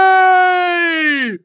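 very high, loud drawn-out cry; triumphant shout. Acted. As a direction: bellow it in triumph.

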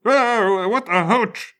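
put-on high, quavering old man yelp; a startled old man squawking; high startled geezer cry; frightened old man. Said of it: "as they make frightened old man sounds".

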